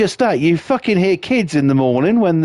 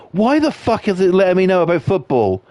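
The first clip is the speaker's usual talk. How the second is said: ordinary delivery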